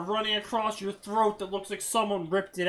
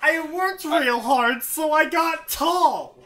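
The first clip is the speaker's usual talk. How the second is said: loud, high mock recital; mocking quote; 6 semitones higher, 7 dB louder than usual. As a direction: belt it out, stretching the end. Mocking the story's line.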